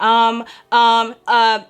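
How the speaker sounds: loud, drawn-out hesitant ums; imitated stammering. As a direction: imitate someone stuck for words. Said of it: "he was just stuttering".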